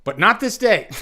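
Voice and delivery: high, loud heroic declaration; Aragorn impersonation. Pretend. Streamer finishing the Aragorn line, loud and high. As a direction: proclaim it like a king before battle. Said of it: "my best, um, Aragorn impersonation".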